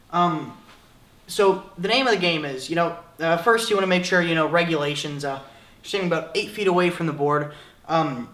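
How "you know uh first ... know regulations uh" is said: ordinary talk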